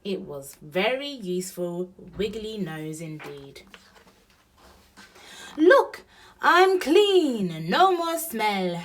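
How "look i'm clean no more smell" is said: high, loud, drawn-out delight; happy shout. A narrator's reading. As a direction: jubilant young elephant showing off. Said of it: "shouted Elephant happily".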